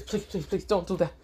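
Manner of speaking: high, soft mock begging; small high-pitched plea, played; pleading. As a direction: beg timidly for mercy. Fallen angel begs for mercy.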